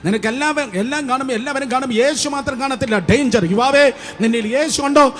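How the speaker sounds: high, forceful sermon challenge; urgent preaching. Real, not acted. Urgent warning to youth. (ആവേശത്തോടെ താക്കീത് നൽകുന്ന പ്രസംഗം)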